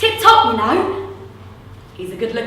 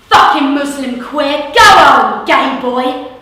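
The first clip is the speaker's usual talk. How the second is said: abusive yell; 7 dB louder, slower than usual. Acted. Thug hurls abuse.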